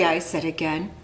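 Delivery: plain reading voice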